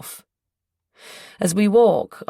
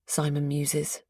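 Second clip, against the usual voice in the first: ordinary delivery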